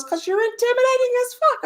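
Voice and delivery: hammed-up impression of an emphatic friend; mimicking Bradley. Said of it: "He goes".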